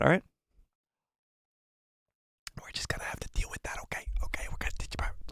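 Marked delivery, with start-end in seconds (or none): 2.6-4.1 fast breathy whisper. Shown as a demonstration. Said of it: "I'm losing my voice bad today". 4.2-5.3 quick voiceless whisper; whisper, no voice left. Shown as a demonstration. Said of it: "I'm losing my voice bad today".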